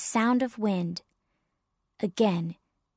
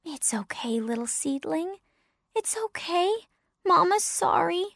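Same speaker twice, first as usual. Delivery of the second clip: tender, cooing reassurance; Mama Pine; 7 semitones higher than usual. Acted. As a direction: gently comfort a crying little one. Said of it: "Mama Pine".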